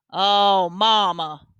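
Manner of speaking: let-down Southern groan of a daughter; upset, disappointed, Southern. A narrator's reading. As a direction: sink into dismay on a drawn-out Southern plea. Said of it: "Mama sounded real upset and disappointed and Southern".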